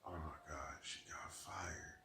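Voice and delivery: hushed, worried whisper; whispering to himself, scared she got fired; whispered alarm. Retold or imitated. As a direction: breathe it like a private panic. Retold panicked thought on arrival.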